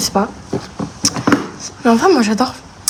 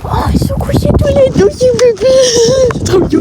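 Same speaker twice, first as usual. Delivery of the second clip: cooing; 11 semitones higher than usual. Real, not acted. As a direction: coo over sleeping foals. Cooing at the foals lying down.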